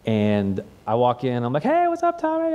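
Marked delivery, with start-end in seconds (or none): 1.6-2.6 much higher, breezy hello; bright greeting. Retold or imitated. Counselor breezily greeting a camper back from free time, bright and high. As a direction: greet him like nothing's wrong.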